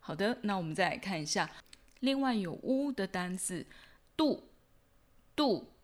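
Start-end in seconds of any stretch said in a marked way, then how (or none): 4.2-5.7 度 hit hard with a sharp falling pitch; stressed short 度. Shown as a demonstration. Teacher showing learners the accented vowel, stressed 度. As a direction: punch 度 as one stressed, falling syllable. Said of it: "发音上也会更重一点".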